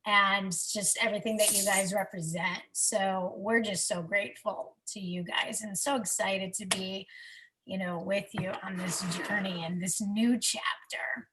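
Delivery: soft, low, emotional thanks; thanking mentors, hushed and tearful. Real, not acted. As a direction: hold back tears and speak softly.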